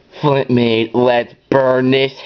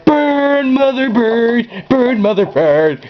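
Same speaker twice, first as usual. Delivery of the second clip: cackle-ready villain relishing the fire; hammed-up evil gloat; villain glee; 10 semitones higher, slower than usual. Gleeful villain cry at fire.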